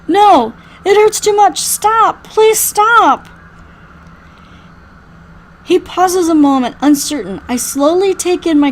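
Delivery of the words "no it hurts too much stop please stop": loud, high desperate plea; pained pleading. Acted. Injured survivor says it hurts, desperate plea. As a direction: cry out in agony, begging.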